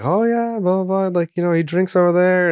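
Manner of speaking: high, bouncy mock local guide; imitating the Liverpool tour guide, high. Tour guide voiced in story.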